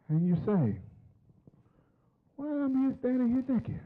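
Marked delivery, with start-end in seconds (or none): 2.4-3.8 confused question. Retold or imitated. Confused self in story.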